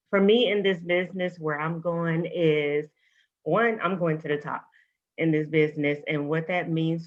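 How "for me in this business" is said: usual speaking voice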